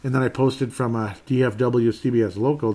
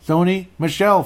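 louder, exasperated correction of Sony Michelle; annoyed correction; 7 semitones higher, 7 dB louder than usual. Real, not acted. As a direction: fix the name, fed up with yourself. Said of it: "I am getting annoyed".

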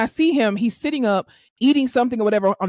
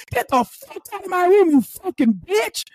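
higher, cussing her out; retold angry yell; 8 semitones higher than usual. Retold patient throwing therapist out, mock angry yell. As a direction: spit it at her, slowing on the insult.

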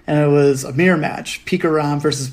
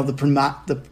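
ordinary talk, nothing marked

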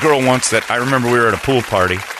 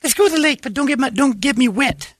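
very high whiny falsetto; hammed-up squeaky girl; mock girly whine. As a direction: whine it again and again. Mimicking fussy girl.

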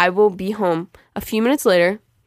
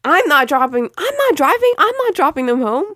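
indignant; 6 semitones higher, 5 dB louder than usual. Real, not acted. Indignant protest to mom.

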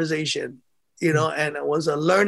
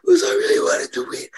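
put-on much higher, crying kid's whimper; teary child; faster than usual. Young boxer answering his dad through tears, crying. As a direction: sob it out like a boy who lost.

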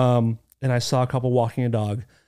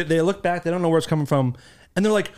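ordinary talk

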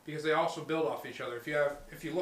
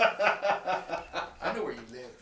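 creepy threat; much slower than usual. Pretend. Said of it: "mock threat, slow".

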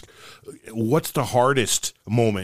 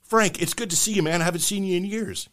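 higher, softer deaf-speech voice; deaf guy voice; 7 semitones higher, 4 dB quieter than usual. Acted. Joke teller voicing deaf friend, lightly higher and soft. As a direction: lightly mimic a deaf man's greeting. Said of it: "They said".

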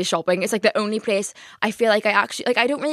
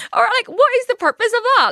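much higher and louder, baffled; incredulous high question; 5 dB louder than usual. Real, not acted. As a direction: ask it in high disbelief.

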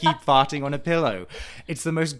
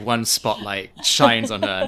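ordinary delivery

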